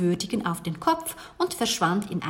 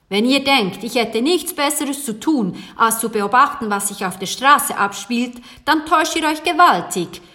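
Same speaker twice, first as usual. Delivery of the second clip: loud, irritated shopkeeper; unfriendly grumble; 3 semitones higher, 7 dB louder than usual. A narrator's reading. Baker rebuffing two children's questions, unfriendly. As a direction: let the annoyance out loudly, a gruff brush-off. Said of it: "brummte der Bäcker unfreundlich".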